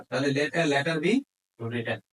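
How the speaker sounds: ordinary delivery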